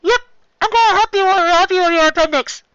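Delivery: high, loud doctor orders; high and loud, busy and cheerful; loud Chopper. Acted. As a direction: call out brightly as the little doctor. Chopper playful.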